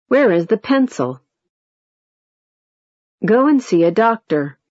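each sentence ends with a clear pitch fall. Shown as a demonstration. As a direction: let every line drop at the end. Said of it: "A falling tone".

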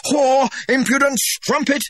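high, loud enraged shout; furious shout. Acted. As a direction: spit the insult out in a rage. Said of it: "he shouted".

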